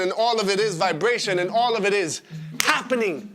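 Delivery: high, rapturous outpouring; ecstatic build. Acted. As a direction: ride the rush towards a climax. Performed climax of lovemaking.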